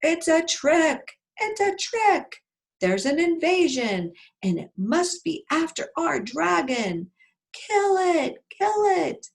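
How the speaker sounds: high, loud alarm cry; angry yelling mob. Acted. Wild boars raising the alarm against an invader, high yelling. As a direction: raise the alarm at full voice, drawing out the cries. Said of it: "all yelling".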